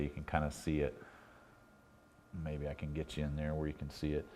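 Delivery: usual speaking voice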